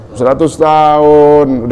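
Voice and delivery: long held syllables; drawn-out emphasis. Acted. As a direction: drag the words out to show how long it was. Stressing the long years of worship.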